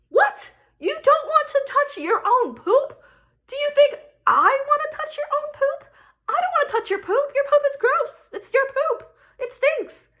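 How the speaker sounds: high, loud incredulous comeback; hammed-up outrage, tumbling out in disbelief; outraged. Mom throwing daughter's disgust back at her, high loud outrage.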